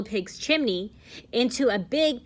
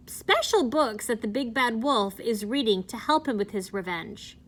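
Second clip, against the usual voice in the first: plain reading voice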